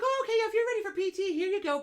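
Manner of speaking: high, bright mock-cheerful; breezy mock-cheerful PA voice; chirpy PA voice. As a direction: imitate the breezy assistant. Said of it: "So Morgan's like".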